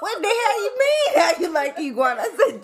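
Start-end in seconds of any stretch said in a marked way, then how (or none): 0.0-1.2 incredulous shout. Retold or imitated. Retold shocked reply about iguana. 1.2-2.2 laughing disbelief. Retold or imitated. Retold shocked reply, laughing.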